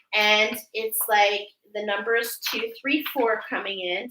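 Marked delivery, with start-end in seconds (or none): none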